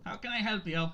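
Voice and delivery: high cheerful hello to a customer; chirpy halfling greeting. Acted. Halfling shopkeeper greets Primrose, high and cheerful. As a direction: pop up and greet them brightly, high. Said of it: "He goes".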